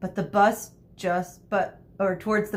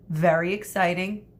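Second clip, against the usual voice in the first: usual speaking voice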